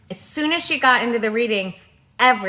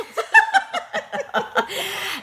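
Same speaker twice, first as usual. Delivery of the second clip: 1 high giggle; 11 semitones higher than usual. Real, not acted. Giggling at the reveal.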